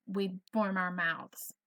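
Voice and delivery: ordinary talk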